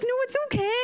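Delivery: high flustered reassurance; hurried reassurance. Retold or imitated. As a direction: rush to stop them. Defending nervous doctor.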